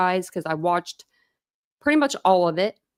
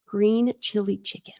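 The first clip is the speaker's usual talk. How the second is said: whisper; 3 semitones higher than usual. Real, not acted. Whispered reading from chat.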